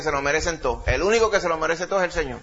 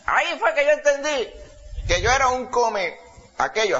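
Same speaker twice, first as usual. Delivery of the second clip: put-on high old man realising; old man's voice; 8 semitones higher than usual. Old man realising he was proud, high old man's voice. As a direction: pitch it up as the old man getting the point. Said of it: "hablaba con esa voz".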